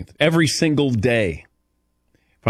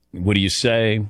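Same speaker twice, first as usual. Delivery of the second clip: normal talking voice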